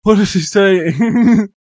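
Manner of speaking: slow, high, baffled. Retold or imitated. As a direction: drag it out, utterly confused. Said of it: "I'll just be like".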